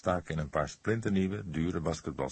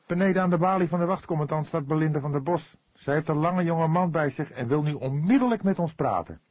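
fast, higher, louder rush; urgent report; 10 semitones higher, 7 dB louder than usual. Acted. Fledder rushes to tell the cook who waits below, urgent. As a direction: blurt out the news in a hurry. Urgent news after phone call.

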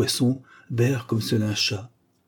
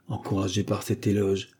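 ordinary delivery, nothing marked

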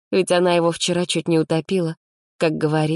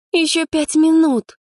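sleepy whine; 13 semitones higher, 5 dB louder than usual. Acted. Sleepy girl begging to sleep.

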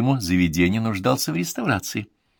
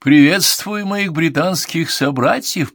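northern gentleman; 8 semitones higher, 5 dB louder, slower than usual. Acted. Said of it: "с чистым выговором северянина".

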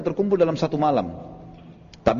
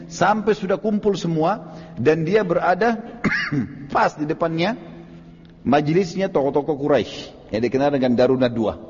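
ordinary talk, nothing marked

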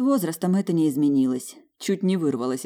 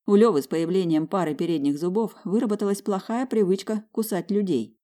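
ordinary delivery

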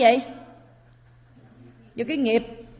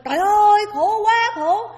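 high, loud anguished wail; high, loud wail of suffering souls; crying out; 10 semitones higher, 7 dB louder than usual. Acted. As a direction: cry out in torment from hell. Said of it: "la lên".

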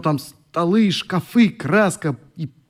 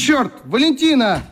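higher, slow exasperated shout of her name; irritated call; 9 semitones higher than usual. Acted. Sick brother snapping at his sister, exasperated. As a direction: drag the name out in annoyance. Oleg calls angrily to sister.

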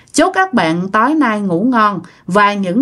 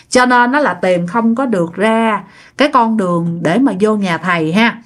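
plain reading voice

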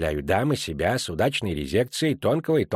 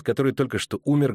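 ordinary delivery, nothing marked

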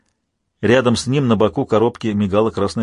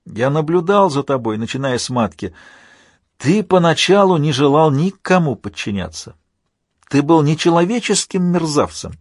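higher-pitched villain, building in accusation; taunting villain Alpha; 5 semitones higher than usual. Acted. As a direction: needle the hero in a raised, insolent voice.

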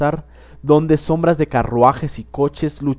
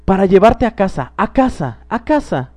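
put-on child's voice climbing higher on each a casa; excited little girl; 6 semitones higher than usual. A narrator's reading. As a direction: chant it gleefully, rising each time. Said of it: "decía la niña palmoteando".

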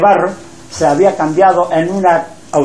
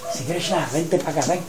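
hushed call; 3 semitones lower, 12 dB quieter than usual. Acted. Lakshmi calls Krishna aside.

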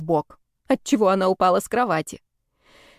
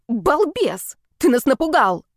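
shout; 6 semitones higher, slower than usual. Acted. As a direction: yell at him after a fright. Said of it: "Женькин крик".

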